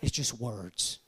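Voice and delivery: flat, low, soft mumble; just words, no thought behind them. Shown as a demonstration. To the congregation, mimicking a hollow mumbled answer. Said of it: "I'm not even thinking about the question".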